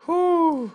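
sharp, high gasp of relief; high relieved whew. Real, not acted. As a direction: blow out the fright. Relief after the scare.

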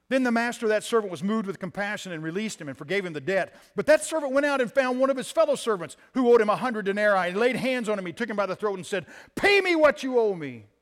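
loud, high dramatic scripture reading; full-force preaching. Acted. Pastor reading the parable to the church, loud and high. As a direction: thunder the parable out.

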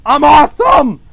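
loud, high mock boast; hammed-up triumphant holler; boastful shout. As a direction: yell it like a silly show-off.

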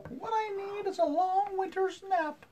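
high put-on tired mom voice; sniffly mom voice. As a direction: sigh it out as a worn-out mom. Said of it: "Mom sniffed".

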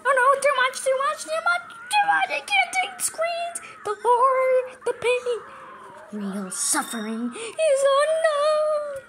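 high, loud wail of mock torment; melodramatic agony. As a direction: ham up the horror, stretching the last words. Overwhelmed by game's screams and horror.

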